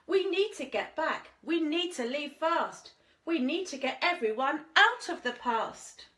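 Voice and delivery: mild urgency as Danny; a child pleading to escape; urgent child. Acted. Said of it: "Danny's urgent plea".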